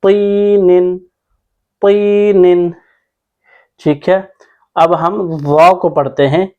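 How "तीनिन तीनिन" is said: तीनिन with a thick emphatic "t" and long "ee"; slow, higher-pitched chant of तीनिन; heavy Taa. Shown as a demonstration. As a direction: draw out तीनिन on a heavy first letter. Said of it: "मोटा पढ़ना है".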